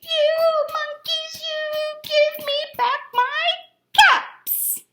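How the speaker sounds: very high, loud, slow sing-song shout; shouting peddler. A narrator's reading. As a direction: yell it at the monkeys. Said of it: "shouted at the monkeys".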